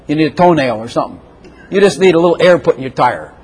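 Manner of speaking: ordinary talk, nothing marked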